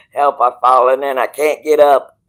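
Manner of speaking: loud hammed-up cry, like the old TV alert ad; mock commercial cry for help. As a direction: belt out the catchphrase as a mock plea. Quoting the famous alarm commercial.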